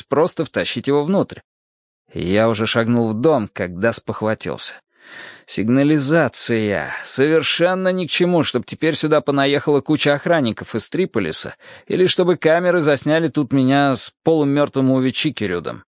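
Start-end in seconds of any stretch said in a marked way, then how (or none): none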